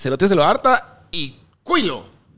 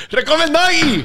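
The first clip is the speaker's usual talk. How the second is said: playful shout; 11 semitones higher than usual. Real, not acted.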